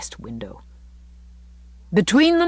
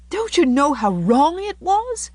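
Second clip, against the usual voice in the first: high, slow, distressed question; quivering voice; 10 semitones higher, 4 dB louder than usual. A narrator's reading. Anne asks Davy about his wrongdoing, quivering. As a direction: appeal to him, hurt and upset. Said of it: "with a quiver in her voice".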